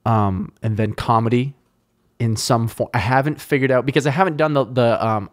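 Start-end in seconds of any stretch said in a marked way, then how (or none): none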